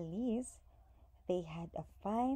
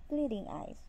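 ordinary delivery

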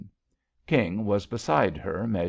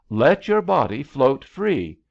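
loud, higher-pitched firm order; shouted instruction; 5 semitones higher, 7 dB louder than usual. A narrator's reading. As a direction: call out instructions mid-rescue. Rescuer ordering panicking swimmer.

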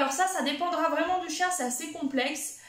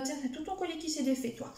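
quiet aside; 7 dB quieter than usual. Real, not acted. Aside to her dog.

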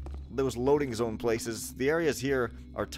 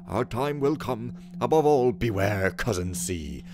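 raised, loud theatrical reading voice; dramatic letter reading; 3 semitones higher, 5 dB louder than usual. Acted. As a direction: read the letter like a stage messenger. Reads in-game letter and text dramatically.

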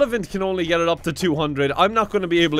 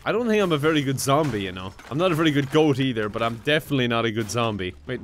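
normal talking voice

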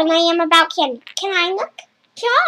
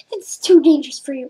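ordinary talk

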